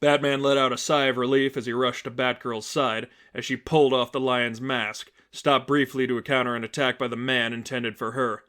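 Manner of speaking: usual speaking voice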